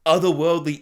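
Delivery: usual speaking voice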